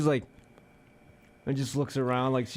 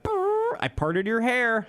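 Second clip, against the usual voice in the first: high lip-trill fart, teasing line; fart noise then joke; 10 semitones higher, slower than usual. Acted. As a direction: blow a raspberry, then quip.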